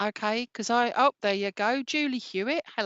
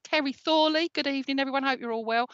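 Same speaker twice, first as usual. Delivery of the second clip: ordinary talk, nothing marked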